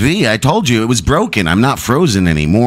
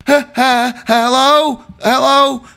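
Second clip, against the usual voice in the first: much higher, nervous prank caller; panicked caller voice; 13 semitones higher, slower than usual. Acted. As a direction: play a frantic caller. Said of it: "I'm supposed to be in a panicked voice".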